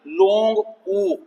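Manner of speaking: लॉन्ग ऊ slow, the ऊ held high; long oo. Shown as a demonstration. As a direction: stretch the ऊ in long ऊ. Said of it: "बड़ा ऊ".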